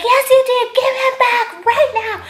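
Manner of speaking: high, loud, furious yell; retold scream. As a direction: shout down the thief in public. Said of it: "And I'm screaming".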